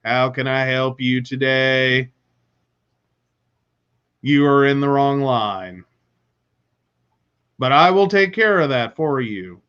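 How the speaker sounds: low, level, blank delivery with long pauses; robotic monotone. Acted. DMV clerk serving a customer, robotic monotone. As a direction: drone it like a lifeless, expressionless robot clerk. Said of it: "monotone voice, no eye contact".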